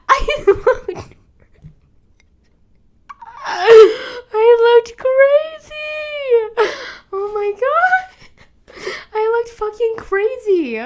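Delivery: high, loud, slow teary laughter; overwhelmed by laughing and crying. Real, not acted. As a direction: laugh through real tears. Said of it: "I was crying on the subway".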